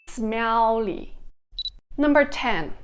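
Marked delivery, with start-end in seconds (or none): 0.1-1.1 "smelly" with a held double L, "smel-li"; singlish. Shown as a demonstration. Singlish take of the word.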